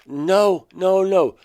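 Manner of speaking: half-shouting no to stop her; urgent protest. Retold or imitated. Seeing the baby held wrong, he cries out an urgent no.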